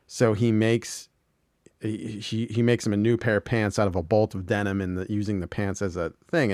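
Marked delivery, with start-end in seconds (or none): none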